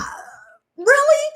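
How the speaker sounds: high, disbelieving really; can't believe this butterfly; high breathy disbelief; incredulous. Retold or imitated. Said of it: "I was thinking".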